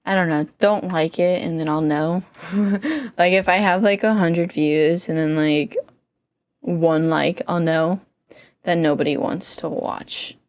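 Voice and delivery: slow, flat, uncaring delivery; monotone voice. Real, not acted. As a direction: talk like nothing matters. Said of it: "I'm gonna stick with the more monotone voice".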